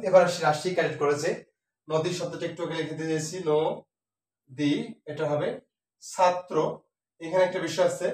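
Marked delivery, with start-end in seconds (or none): 3.5-4.7 নদী as 'no', long pause, 'di'; syllable by syllable. Shown as a demonstration. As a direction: split নদী slowly with a round o. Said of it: "এই জন্য ন হবে". (নো... দি, ধীরে ভেঙে)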